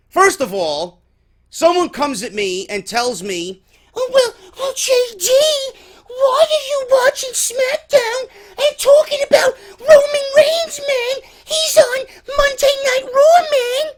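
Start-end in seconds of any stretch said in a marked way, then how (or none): 3.9-13.9 whiny mock fan voice. Mocking a Roman Reigns fan.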